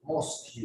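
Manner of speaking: "Mosque" said as "mos-kyu"; mispronounced mosque. Shown as a demonstration. Teacher showing what lots of people say, mispronounced mosque. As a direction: add a "kyu" ending to "Mosque". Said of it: "It's not Mosque".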